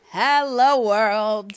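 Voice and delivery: loud, high, joyful greeting to the world; loud giddy hello world; excited shout. Pretend. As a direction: belt it out to everyone. Dreaming of worldwide fame.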